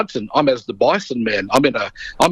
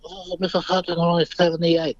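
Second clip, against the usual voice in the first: soft, slightly raised, meek imitation of an elder; Marlon Brando; 4 semitones higher, 4 dB quieter than usual. Brando answering the storyteller's mum about his age, meek imitation. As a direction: lightly imitate a humbled old man, hushed. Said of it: "He goes".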